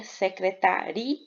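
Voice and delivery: secretaria drawn out as secreta-RI-a; hiatus, vowels separated. Shown as a demonstration. Teacher explaining hiatus, secretaria slowly said with its last vowels apart. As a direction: say secretaria with RI and a as two separate syllables. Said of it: "Fica separadas o I e o A".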